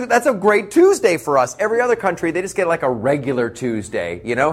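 ordinary talk, nothing marked

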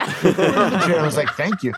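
slow laughing reply; laughing through the words. Real, not acted.

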